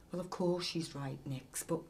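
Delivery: fading away to almost nothing; voice trailing off. A narrator's reading. Ollie trailing off to Nicky, fading quiet. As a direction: let the words die out. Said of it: "Ollie's voice trailed off".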